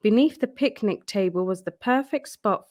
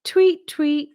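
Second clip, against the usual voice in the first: high, louder tweeting bird; birdsong imitation; 7 semitones higher, 5 dB louder than usual. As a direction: chirp it like a little bird. Birdsong in the story.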